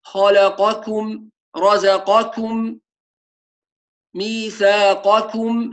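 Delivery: خلقكم slow, voiced ق then whispered ك; ميثاقكم slow, heavy qaf then light kaf; clear qaf and kaf. Shown as a demonstration. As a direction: draw out رزقكم, separating ق from ك. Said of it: "هكذا".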